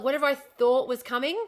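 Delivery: normal talking voice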